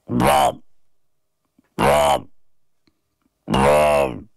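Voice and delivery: imitated low didgeridoo blurt; strange didgeridoo noise. A narrator's reading. Didgeridoo sounding from the shaken boy's gob, strange honk. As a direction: make short didgeridoo honks, dropping low. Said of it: "a strange noise came from my gob".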